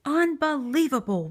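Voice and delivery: rumbling. A narrator's reading. Bear finds the mouse again, rumbling disbelief. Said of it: "rumbled Bear".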